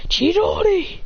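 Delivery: high-pitched anime attack cry; attack call. Acted. Character calls out attack.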